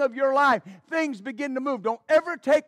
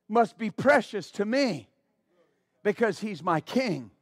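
ordinary talk, nothing marked

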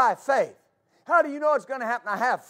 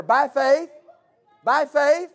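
rising louder, higher shouts of by faith; building shout; 6 semitones higher, 8 dB louder than usual. Real, not acted. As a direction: hammer the answer harder each time. Preacher answering each doubt.

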